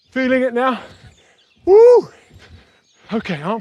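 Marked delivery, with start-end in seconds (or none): 1.7-2.1 high loud joyful woo; excited whoop. Real, not acted. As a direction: whoop to fire up the class. Instructor whoops mid-workout.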